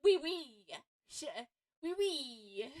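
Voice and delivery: mock French oui oui, loud and bright; a lightly hammed-up French accent; playful French accent, fairly loud. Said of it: "bust out my French accent".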